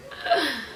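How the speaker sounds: sharp surprised gasp; shocked gasp. Retold or imitated. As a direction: gasp at the unexpected confession. Reacting to "I love her.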